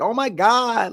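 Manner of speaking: high-pitched mock outcry; imitated yell. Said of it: "He was like".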